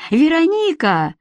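a lightly voiced shout into the phone; calling out. A narrator's reading. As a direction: call her name across the room. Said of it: "закричала она".

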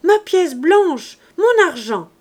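put-on high, loud, slow wail of a robbed child; desperate child cry. A narrator's reading. Child begging the silent man for his coin, loud high wailing. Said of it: "cria l'enfant".

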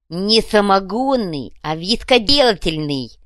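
loud, slow emphatic correction; correcting fuhrer. A narrator's reading. Fuhrer corrects the fence, emphatically. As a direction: spell out the correction with pompous emphasis. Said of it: "поправил скупщика краденого фюрер".

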